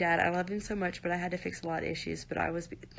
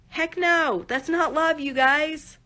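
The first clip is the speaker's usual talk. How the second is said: loud and high, really meaning it; a real burst of protest, loud and raised; emphatic outburst; 9 semitones higher, 8 dB louder, slower than usual. Real, not acted. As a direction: shout down the idea that this was love. Outburst rejecting his claim.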